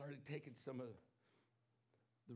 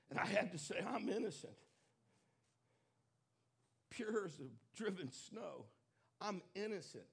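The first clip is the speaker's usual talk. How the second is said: loud, higher preaching voice, pressing each phrase; full-force preaching; 4 semitones higher, 6 dB louder than usual. Acted. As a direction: preach it out to the congregation with fervor.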